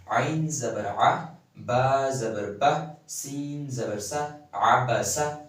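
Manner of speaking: slow spelling drill with "آ", "با", "سا" each cut very short; short zabar vowels. Shown as a demonstration. Teacher spelling out short vowels for students. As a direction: sound out "عین زبر آ" slowly, the vowel "آ" barely touched. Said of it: "اسے جو ہے نا چھوٹا پڑھنا ہے".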